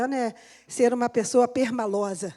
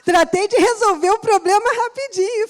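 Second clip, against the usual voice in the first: laughing through the words, louder and higher; 7 semitones higher, 7 dB louder than usual. Real, not acted. Woman laughing at her own story to the church. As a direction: giggle it out, amused at herself.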